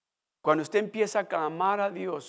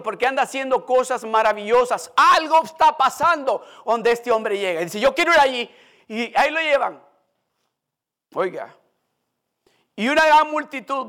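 raised, driving sermon voice; louder impassioned preaching; fervent preaching; 5 semitones higher, 7 dB louder than usual. Acted. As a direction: preach it out to the congregation. Preacher retells Bartimeo story fervently.

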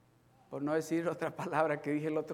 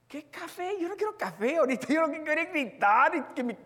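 higher, fast exasperated retort; mock angry outburst; 10 semitones higher, 6 dB louder than usual. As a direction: blurt out you just want to yell. Angry man refusing coffee.

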